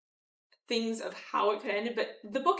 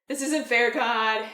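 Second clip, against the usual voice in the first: throwing a fit; 8 dB louder, slower than usual. Retold or imitated. Jonah complaining to God, loud petulant whine. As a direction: complain like a sulking teenager. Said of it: "and throws a fit".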